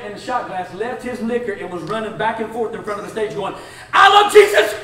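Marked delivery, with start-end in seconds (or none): 3.9-4.8 very high, very loud joyful shout; ecstatic yelling. Retold or imitated. A drunk running before the stage, shouting with joy. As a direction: scream it with joy.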